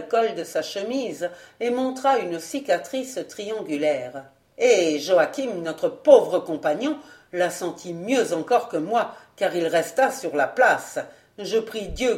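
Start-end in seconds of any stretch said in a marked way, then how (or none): none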